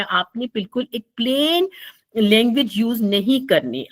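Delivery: ordinary delivery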